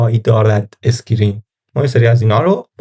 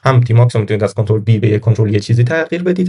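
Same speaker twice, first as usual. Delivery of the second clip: ordinary delivery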